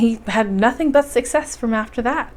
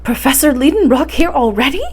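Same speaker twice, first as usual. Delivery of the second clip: raised, louder surprised cry of a housekeeper; the servant blurts out her shock; astonished cry; 5 semitones higher, 6 dB louder than usual. Acted. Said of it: "cried poor Martha in astonishment".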